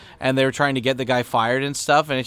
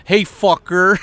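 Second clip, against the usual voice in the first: "Hey, fucker." tossed out raised and loud, mock rude; rude taunt; 6 semitones higher, 7 dB louder, slower than usual. Explaining omae, a louder mock rude jab. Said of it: "the equivalent in English of being like".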